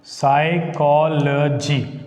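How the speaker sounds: "Psychology" slow, each syllable stretched evenly; divided into syllables. Shown as a demonstration. Teacher dividing a word for the class, slow and syllabified. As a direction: draw out "Psychology" as psy-cho-lo-gy. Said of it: "dividing it".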